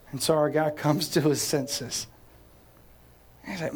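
ordinary talk, nothing marked

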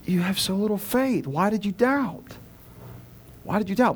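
firm, emphatic reproach without anger; a little frustrated. Acted. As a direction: ask it with mild frustration, not mad. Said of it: "Maybe a little frustrated".

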